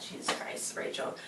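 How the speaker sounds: exasperated whisper. Real, not acted. Viewer scolding the babysitter for the photo, whispered. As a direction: sigh it out under the breath at her. Reacting to half-face photo.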